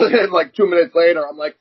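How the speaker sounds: ordinary talk, nothing marked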